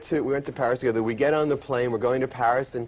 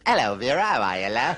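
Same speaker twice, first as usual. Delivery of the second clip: lightly put-on British accent, lower; 4 semitones lower than usual. Comic imitating a British person, light British accent. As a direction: say it like a chatty Brit. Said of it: "something a British person says".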